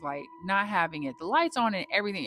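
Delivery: ordinary talk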